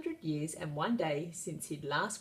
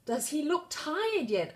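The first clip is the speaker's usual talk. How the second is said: anxious wizard; 10 semitones higher than usual. A narrator's reading. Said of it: "asked the wizard".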